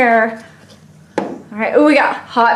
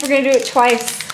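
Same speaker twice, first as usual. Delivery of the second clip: ordinary talk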